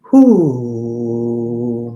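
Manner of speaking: a loud exhaled whoo; loud whoo release. Real, not acted. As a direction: let the energy out with a whoo. Release during energy work.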